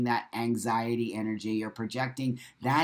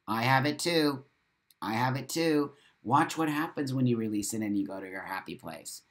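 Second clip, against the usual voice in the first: ordinary talk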